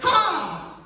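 sharp amused exclamation ha; exclaiming ha. Real, not acted. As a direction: burst out with a ha. Exclamation at retold memory.

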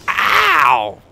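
high, loud cry of "ow"; one big pained shout; loud yelp. Real, not acted. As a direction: yell out on a hard landing. Hard landing in game.

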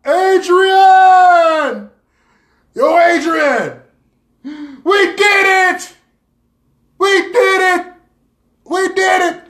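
high, full-out victory shouting; triumphant yell. Acted. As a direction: bellow it like a champion in the ring. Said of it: "I'm gonna yell".

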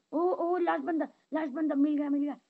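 thrilled gamer calling out the final target; real excited shout. Real, not acted. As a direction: yell it as you spot the last enemy. Finding last enemy.

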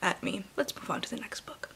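hushed whisper; soft aside whisper. Real, not acted. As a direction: keep it to a whisper. Whispering at the camera.

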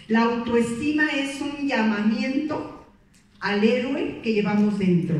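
slow emphatic read for note-taking; slow dictation. Shown as a demonstration. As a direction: dictate it so they can write. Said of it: "esta anótenla".

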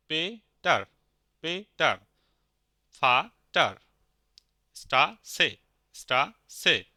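each word split into two clear syllables, "পে-টার", "ফা-টার"; repeat-after-me model. Shown as a demonstration. Teacher asking learners to say along, separated syllables in "পেটার, ফাটার, স্টাসে". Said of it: "আপনারা তাহলে আমাদের সাথে বলেন".

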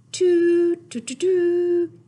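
high, loud toots; fast horn toots for the kids; horn imitation. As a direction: blast it like a toy horn.

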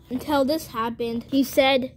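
ordinary delivery

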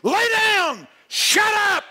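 high, loud barked order; shouted rebuke. Retold or imitated. Jesus yelling at the raging storm, high and loud. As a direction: yell the storm into silence. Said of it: "Jesus Christ says to the raging storm".

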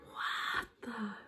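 drawn-out, very soft disbelief; awed near-whisper; hushed amazement. Real, not acted. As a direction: breathe it out, stunned. Repeats amazement under breath.

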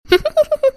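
high-pitched delighted laugh; happy laugh. Acted. Mother delighted at son's consent.

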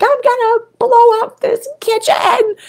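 put-on high, strained voice of her old frustration; frustrated self. Quoting her old frustrated self, high and strained. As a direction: squeeze it out, fed up.

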